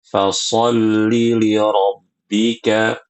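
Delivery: Fasolli li rabbika chanted slowly with the short vowel; short vowel recitation. Shown as a demonstration. Teacher reciting the correct short reading. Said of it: "bacanya pendek".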